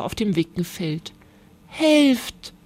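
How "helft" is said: higher, mildly pleading call. A narrator's reading. Babette begging the animals to help the starling, pleading. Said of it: "bat Babette".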